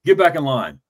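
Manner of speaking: mock drill-sergeant bark; barked order. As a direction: imitate a sergeant ordering a recruit. Retold army order to recruit.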